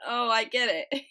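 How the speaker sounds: realization bursting into a loud, high laugh; getting it, then laughing hard; real laughter. Real, not acted. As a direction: crack up as the joke lands. Gets the joke and cracks up.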